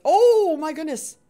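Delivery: loud, high startled exclaim; panicked. Real, not acted. Gamer panicking as she attacks from above. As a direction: yelp it as she strikes.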